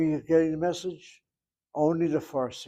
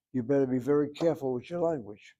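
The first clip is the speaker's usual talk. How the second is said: ordinary talk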